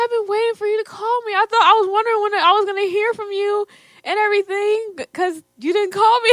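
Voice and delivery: high, quick, pleased voice; happy dad. Retold or imitated. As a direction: sound glad she finally called. Father's warm words retold.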